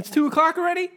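high, loud disbelief; shocked surprise. Real, not acted. Host tells co-host it's two already, shocked. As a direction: yelp it, startled by the time. Just noticed the time.

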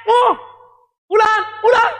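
high, loud mock yell calling him home; wife yelling. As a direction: holler it like a wife calling across the yard. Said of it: "apalagi dengan teriakan". (istri berteriak memanggil suami pulang, teriakan tinggi dan keras)

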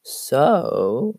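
voice crack. Real, not acted. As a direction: voice cracking on the word. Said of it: "ooh, voice crack".

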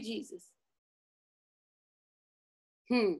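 "Thank you", then a near-silent "Jesus"; hushed worship. Acted. Leader whispering thanks to Jesus in worship, hushed. Quiet worship after the peak.

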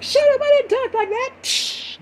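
hammed-up mock motherly protest; indignant mom. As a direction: scold in drawn-out falsetto. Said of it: "my mom would be like".

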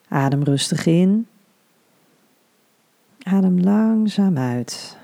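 soothing hypnobirthing guide; guided meditation. Acted.

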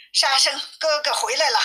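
ordinary delivery, nothing marked

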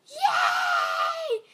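very high, loud gleeful scream; screaming yay. A narrator's reading. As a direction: shriek it with joy. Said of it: "she screamed".

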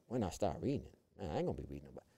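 low, soft dismissive muttering; muttered inner thought. Retold or imitated. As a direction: mumble it low, like a private scoff. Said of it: "And I'm thinking".

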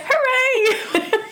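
high-pitched imitation hooray; mock cheer. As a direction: squeal a teasing little hooray. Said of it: "she put her hands up".

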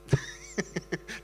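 short airy chuckle; breathy laugh. Real, not acted. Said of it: "I can't stop laughing".

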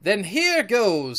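high, slow seaman's outburst; pirate launching himself into a fight; sworn cry. A narrator's reading. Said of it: "with an oath".

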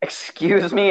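ordinary talk

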